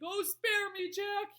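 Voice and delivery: high, loud begging; pleading yell. A narrator's reading. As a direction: yell for mercy. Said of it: "he yelled".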